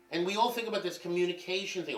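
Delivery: ordinary talk, nothing marked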